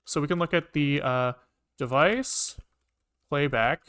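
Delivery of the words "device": usual speaking voice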